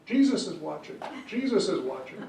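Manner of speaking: mock parrot repeating its warning; joke parrot voice. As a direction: pitch it up like a talking parrot. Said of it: "the parrot is sitting there going".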